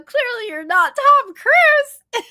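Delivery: high, loud, drawn-out punchline; amused disbelief. Retold or imitated. Woman telling the man he's no celebrity, loud gleeful disbelief. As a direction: crow it slowly, about to laugh.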